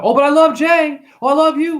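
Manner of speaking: high, loud, syrupy fake adoration; mocking a fan who claims to love Jay; mock gushing fan. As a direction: gush like a fake friend. Mocking fake fans' devotion.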